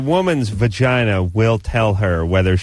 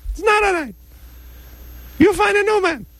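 ferret voice. Pretend. Vagina puppet argues with woman.

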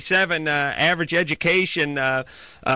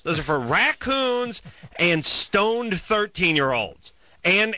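normal talking voice

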